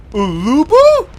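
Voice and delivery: a high, loud startled name; surprised to spot an old friend; sudden shout of recognition; surprised call. Acted. Spots Ulubu unexpectedly.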